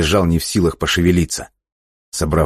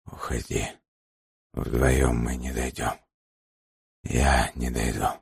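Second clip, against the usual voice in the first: faint, slow, breathy whisper; barely able to speak, fading; weak hoarse whisper; 3 semitones lower, 9 dB quieter, slower than usual. A narrator's reading. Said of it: "с трудом разлепляя опухшие".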